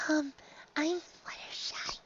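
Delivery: breathy timid whisper, high and slow; shy pony whispering her name; tiny whisper. A narrator's reading. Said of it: "the withdrawn Pegasus mare".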